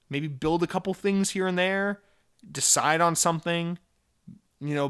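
usual speaking voice

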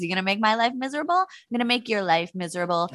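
rattled off quickly in mock revenge; fast mock threat. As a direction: rush through the sweet threat. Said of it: "I'd be like".

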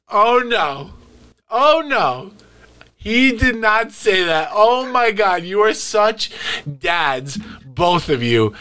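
put-on teen daughter voice. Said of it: "And she goes".

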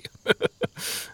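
soft breath-only snickering. A narrator's reading. Others laughing at the traveler's coat, snickering. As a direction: snigger quietly under the breath. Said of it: "The others snickered".

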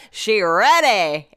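mock-triumphant, slow declaration; excited announcement. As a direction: stretch it out like a gleeful verdict. Mimics her excited late-night thought.

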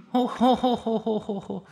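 high, lofty oh-ho-ho laugh; a rich girl's haughty ho-ho-ho; ojou-sama laugh. Acted. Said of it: "お嬢様口調". (お嬢様の高笑い)

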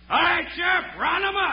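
loud, high commanding call; shout. Acted. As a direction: yell the order across the yard. Calling out to the sheriff.

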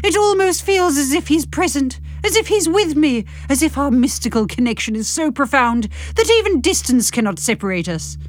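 high, swelling romantic narrator; melodramatic narration. A narrator's reading. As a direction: narrate it with breathless rapture. Hammy narration of mystical connection.

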